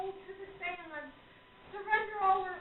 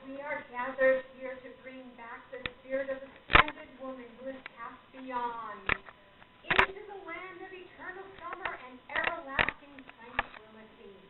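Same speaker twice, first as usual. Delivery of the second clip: a confident, provocative, lightly theatrical medium's voice, lower; the arresting voice of a showy psychic at the crystal; 5 semitones lower, 6 dB louder than usual. Acted. Medium opening the seance for her guests, mildly theatrical and arresting. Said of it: "manner is both confident and provocative. The voice is arresting".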